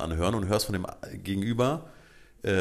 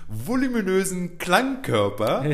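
laughing through the punchline, louder and higher; can't hold the laugh back while telling the joke; 10 semitones higher, 8 dB louder than usual. Real, not acted. To his co-host about the callcenter crew, laughing louder and higher.

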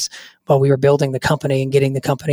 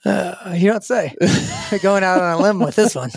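dry mock surprise, higher; sarcastic; 5 semitones higher than usual. Mock praise for a safe prediction.

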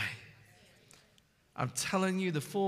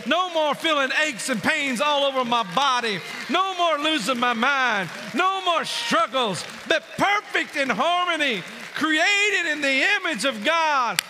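higher and louder, each no more hammered; preaching at full force; 5 semitones higher, 6 dB louder than usual. Acted. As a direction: build the list to a triumphant cry. Preacher's climactic list.

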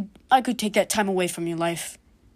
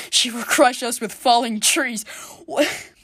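ordinary talk, nothing marked

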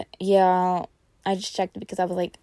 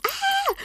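retold scream. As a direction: re-enact a shriek of joy. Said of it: "So I screamed. I was like".

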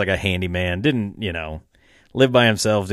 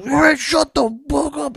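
much higher, slow, mock-angry put-on voice; mock angry uncle; slower than usual. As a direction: hush the boys like the cranky uncle, hammed up. Uncle snapping at noisy boys.

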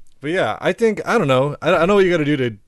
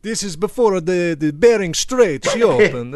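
mock Italian accent; 5 semitones higher than usual. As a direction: explain it like a comic Italian. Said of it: "the Italian accent is approaching".

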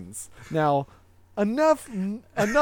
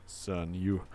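low, softer father voice; stern dad; 10 semitones lower, 8 dB quieter than usual. Acted. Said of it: "so when the dad says".

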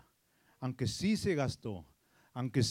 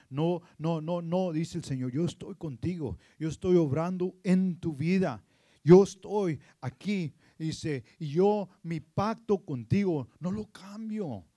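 louder, rising fervent promise; building proclamation; 3 semitones higher, 11 dB louder than usual. Real, not acted. Preaching God's promise rising. (proclamación creciente)

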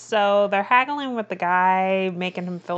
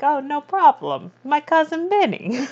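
much higher, lilting mock confidence; breezy retold reassurance; 10 semitones higher than usual. Voicing the accused men who need a lawyer, high and breezy. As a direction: pitch it way up, playfully carefree.

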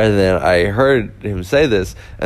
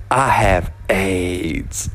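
slow, drawn-out stand-up character voice; homeless man; much slower than usual. Retold or imitated. Homeless man introducing himself to comedian, slow mock announcement. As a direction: draw each word out in a mock announcement. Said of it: "homeless person was trying to figure out".

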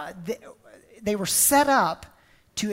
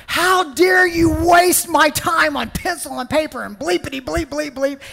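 shouted angry boss, higher and louder; mock tirade; 6 semitones higher, 7 dB louder than usual. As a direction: bellow it like an outraged boss at a newbie. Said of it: "he would unleash this tirade of cuss words".

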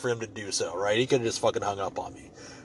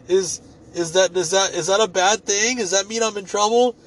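mock innocent; 7 semitones higher, 4 dB louder than usual. Mock innocent reply to insults.

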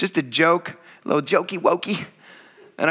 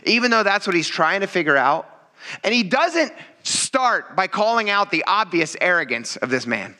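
ordinary talk, nothing marked

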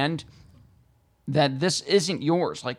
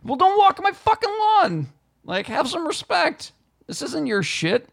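high-pitched indignant yelling; outraged, strained high rant; screaming lawn grouch; 11 semitones higher than usual. Acted. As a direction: shriek at trespassing neighbours, hammed-up. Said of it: "I am the guy that screams".

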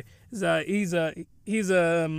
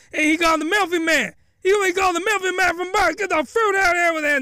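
high, loud, fast comic tirade; hammed-up outraged refusal to the wife; mock furious husband; 10 semitones higher, 3 dB louder, faster than usual. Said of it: "He's like".

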